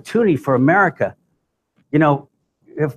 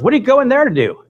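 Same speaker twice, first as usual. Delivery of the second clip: louder, much higher mock-incredulous question; nosy questioning; 10 semitones higher, 5 dB louder than usual. Woman questioning the speaker in the shuttle, nosy. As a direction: imitate a nosy woman, high and loud.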